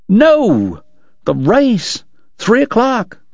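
high, startled exclamations; sudden alarm. Acted. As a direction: dentist remembers the race at three, startled. Doc suddenly remembers the race.

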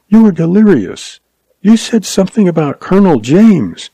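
high, lilting female character; woman's voice. A narrator's reading. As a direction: raise the pitch to play the woman. Lois speaking closely to Frayne.

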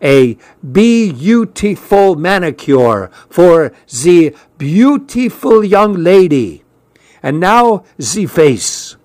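slow, high, hammed-up French accent; bad French accent. A narrator's reading. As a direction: stretch it out like a fake French beautician. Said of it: "in a bad French accent".

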